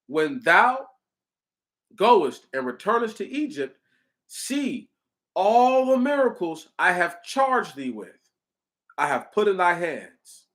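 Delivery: emphatic, measured Bible recitation; scripture reading. Acted. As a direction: read out the verses as solemn proclamation. Resumes reading scripture aloud.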